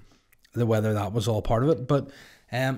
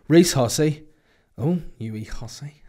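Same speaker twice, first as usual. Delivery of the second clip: ordinary talk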